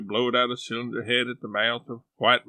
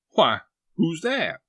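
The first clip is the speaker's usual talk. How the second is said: a touch of surprise, slow; lightly voiced startled old man; 5 semitones higher, 5 dB louder than usual. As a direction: stare and ask.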